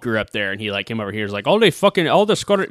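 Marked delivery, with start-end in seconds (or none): 1.5-2.7 high-pitched, shaky attempt at a Scottish accent. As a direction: imitate a Scottish customer, pitched up. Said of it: "try to refine my Scottish accent".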